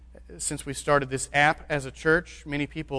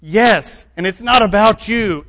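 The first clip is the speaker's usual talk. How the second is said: louder and higher, hitting not; emphatic answer; 6 semitones higher, 10 dB louder than usual. Real, not acted. Preacher to his congregation, loud and emphatic. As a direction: answer them firmly.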